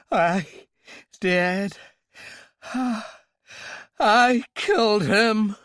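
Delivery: high, loud anguished confession; moaned. Acted. Royale confessing to Talbot, loud high moan. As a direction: wail it out, broken. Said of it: "Royale moaned".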